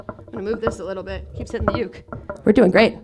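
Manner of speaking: muttered aside. Real, not acted. Off-mic aside fixing uke.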